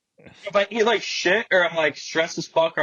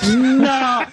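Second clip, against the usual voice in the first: loud, high, drawn-out refusal; disbelieving outburst; 8 semitones higher, 6 dB louder than usual. Retold or imitated. Valet sees a huge puddle under the car, disbelieving outburst. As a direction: wail it in laughing disbelief.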